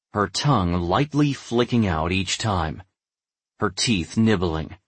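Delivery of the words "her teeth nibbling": ordinary delivery, nothing marked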